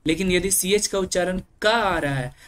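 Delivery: normal talking voice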